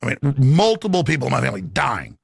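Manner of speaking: ordinary talk, nothing marked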